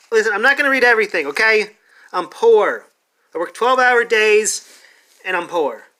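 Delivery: real frustrated ranting, fast and loud; exasperated rant. Real, not acted. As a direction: blast it out at the know-it-alls. Ranting at imagined gatekeeping fans.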